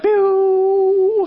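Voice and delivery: high, loud zap noise; popping sound effect. Retold or imitated. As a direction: zap the bubble into place.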